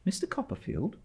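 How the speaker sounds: a soft widow answering her visitor; young mother. A narrator's reading. Widow to stern aunt, lightly voiced young mother.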